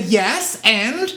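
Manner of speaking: high, loud, unimpressed retort; challenging comeback. Retold or imitated. Actress answering the studio's doubts, challenging. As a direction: throw it back as a pointed question. Voicing actress brushing off objection.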